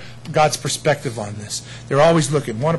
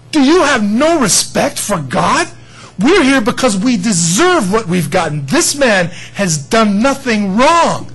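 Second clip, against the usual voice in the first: loud, slow, high scolding from a condemned man; stern rebuke; 5 semitones higher, 6 dB louder, slower than usual. Acted. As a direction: hammer each word of the rebuke home. Thief rebukes the other thief.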